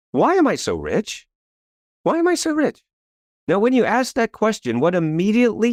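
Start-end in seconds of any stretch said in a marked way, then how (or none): 2.0-2.8 higher, bright ringing second take; much higher, delighted re-asking; excited repeat. Shown as a demonstration. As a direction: ask it again like a thrilling discovery. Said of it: "And then I said".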